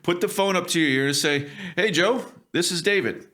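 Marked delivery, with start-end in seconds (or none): 1.8-3.3 confident intro. Shown as a demonstration. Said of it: "Announce yourself with some presence".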